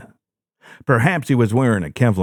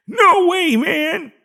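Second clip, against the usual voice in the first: chuckling; slower than usual. A narrator's reading. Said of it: "he chuckled".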